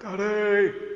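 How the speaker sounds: high, loud imitation of a little boy; small child calling. Preacher imitates his son calling from bathroom, high loud call. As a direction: call out like an excited wee son. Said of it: "and then you hear this".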